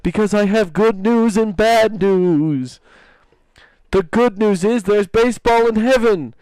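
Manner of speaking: slow punchline delivery. Retold or imitated. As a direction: draw out the punchline slowly. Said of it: "He's like".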